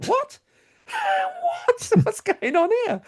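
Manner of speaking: high, bewildered What with a laugh; baffled. Retold or imitated. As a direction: act thrown and confused, voice shooting up. Baffled reaction retold.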